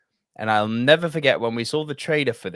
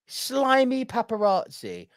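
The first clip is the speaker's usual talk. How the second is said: ordinary delivery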